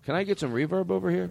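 normal talking voice

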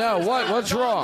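high-pitched startled questions; suddenly alarmed, pitch jumping up; mock alarm. Host reacts to warnings about burning paper, mock alarm.

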